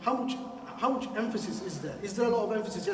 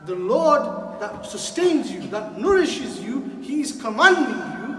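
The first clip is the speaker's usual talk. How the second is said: louder and higher, slow and insistent; rising preaching emphasis; 4 semitones higher, 10 dB louder than usual. Real, not acted. Preacher urging the young friends, loud rising emphasis. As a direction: drive each phrase home to the crowd. Sermon climax on Allah's command.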